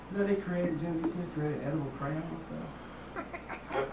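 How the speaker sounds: usual speaking voice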